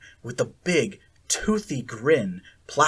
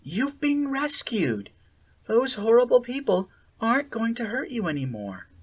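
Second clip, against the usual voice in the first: Sally Ann's voice; 9 semitones higher than usual. A narrator's reading. Sally Ann reassuring Akira, sweetly false. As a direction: soothe with false kindness.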